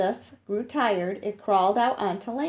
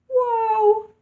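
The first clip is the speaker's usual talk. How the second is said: high, loud amazed whoa; 12 semitones higher, 5 dB louder than usual. Real, not acted. Reacting to teeth as big as bananas, high amazed whoa. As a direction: gasp out a wowed whoa.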